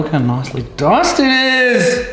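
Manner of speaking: high, proud show-off tone; chuffed pitch-up brag; proud excitement. Real, not acted. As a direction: show off the tomato, delighted. Proud of diced tomato.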